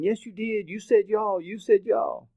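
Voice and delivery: high-pitched mimicked kids' taunt; accusing kids. Imitating kids pointing and accusing.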